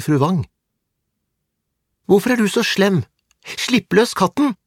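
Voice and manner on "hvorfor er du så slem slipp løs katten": put-on high-pitched child's angry protest; acted outraged plea; indignant demand. A narrator's reading. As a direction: scold him in a high girl's voice. Girl confronts boy hurting the cat.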